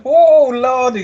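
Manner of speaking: mock shock.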